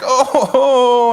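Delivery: put-on high, loud-ish wail of a hurt little boy; mock crying. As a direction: imitate a kid starting to cry. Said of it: "He starts to cry".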